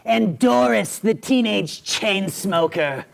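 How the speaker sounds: drawn-out comic character voice; teenage chain smoker. Acted. Host introducing one of his characters, in her voice. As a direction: stretch the name out in character.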